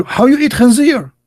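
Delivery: high, mock-indignant "How you eat khanzir?"; outraged friend. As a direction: play the scandalised friend, voice up high. Retold quarrel, friend outraged.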